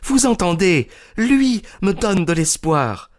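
high, triumphant and hopeful; high, defiant young woman's voice. A narrator's reading. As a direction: rub it in Lestrade's face. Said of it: "d'un air de défi".

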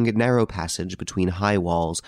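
ordinary delivery

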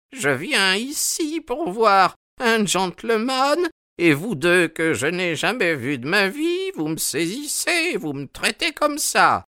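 put-on very high, querulous old man's voice; high-pitched aged outrage; indignant old sailor. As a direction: protest like an affronted elder. Old sailor protests being held.

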